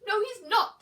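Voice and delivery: high, loud scream. Acted. Eddie screaming at Mum to look behind her. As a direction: scream the warning. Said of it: "screamed Eddie".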